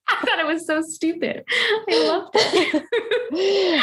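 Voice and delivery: giggling through the words, high and breaking; talking through real giggles; laughing. Real, not acted. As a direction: laugh it out, pitch jumping up. Giggling about stupid joke.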